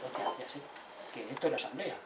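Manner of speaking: hushed aside, dawning understanding; whispered realization. Retold or imitated. Visitor realizing to himself, in a whisper. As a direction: whisper it to yourself as it clicks.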